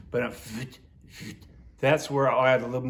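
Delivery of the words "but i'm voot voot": "voot" said low and soft, like a duck call; voot call sound. Shown as a demonstration. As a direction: breathe "voot" quietly from the throat. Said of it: "I'm kinda doing the voot again".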